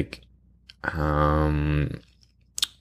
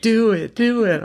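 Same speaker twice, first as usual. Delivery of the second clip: high, insistent peer-pressure chant; mock egging on; 3 dB louder, slower than usual. Twenty imagined co-hosts all around, egging on. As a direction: goad them in a high mocking voice.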